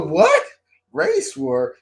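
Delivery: very high, disbelieving question; incredulous echo. Retold or imitated. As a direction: repeat it back in mock shock. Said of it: "I go".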